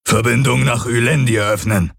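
a lightly different voice, commanding; Hox calling out. A narrator's reading. Hox calls commands to the teleporter, lightly voiced. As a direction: command the machine. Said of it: "rief der Aur".

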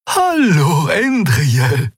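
high, sing-out hello; good-humoured greeting. Acted. Xea greets Endriel on the bridge, good-humoured. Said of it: "Sie blinzelte gut gelaunt".

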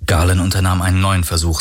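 ordinary delivery

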